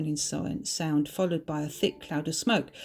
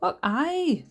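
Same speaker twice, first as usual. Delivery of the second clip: high, cheery Scottish exclamation; Scottish accent; 10 semitones higher, 4 dB louder than usual. A narrator's reading. Bertha accepts the accent compliment, smiling Scottish voice. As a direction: say it with a smile, broad Scottish. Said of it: "smiled Bertha".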